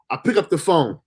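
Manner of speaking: usual speaking voice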